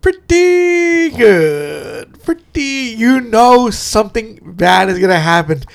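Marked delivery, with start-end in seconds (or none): 0.0-2.9 slow, drawn-out, high "pretty good"; Larry David impression. Imitating Larry David's catchphrase, slow and drawn out. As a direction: stretch it out like Larry David sizing someone up. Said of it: "anytime you hear him go".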